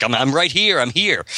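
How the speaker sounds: mildly acted cry toward a voice. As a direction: lift the pitch as if answering someone. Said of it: "she cries out again for the voice".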